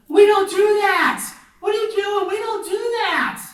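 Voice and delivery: appalled teacher shout. Retold or imitated. Teacher catching kids setting toilet paper alight, appalled high shout. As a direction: re-enact panicked scolding, starting loudest.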